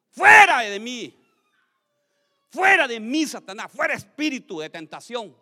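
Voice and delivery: acted exorcist's cry; rebuking Satan. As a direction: cast it out with force.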